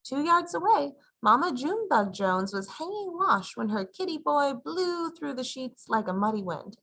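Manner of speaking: plain reading voice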